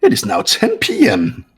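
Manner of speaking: chirpy high PA announcement; sing-song nighttime announcement; announcement read. Acted. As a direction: read it like a cheery school broadcast. Mascot reads nightly announcement.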